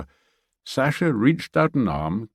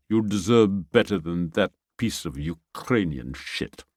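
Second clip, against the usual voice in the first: lightly voiced Russian soldier; gruff thanks, then contempt; Russian accent. Sasha thanks Bruno and Pia.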